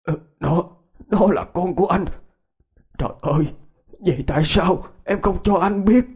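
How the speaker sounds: high, stammering shock; stunned and faltering, rising to anguish; trembling voice. A narrator's reading. Man learning the child is his, voice trembling. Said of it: "giọng ông run run".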